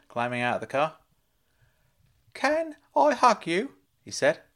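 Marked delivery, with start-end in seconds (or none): 2.3-3.8 much higher, slow, loud uncle voice; slow high fussing uncle; Uncle Claude, slow. Acted. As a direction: ask it slowly, high and eager like a fussing uncle.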